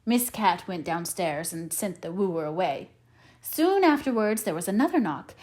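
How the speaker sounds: plain reading voice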